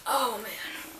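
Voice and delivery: ordinary delivery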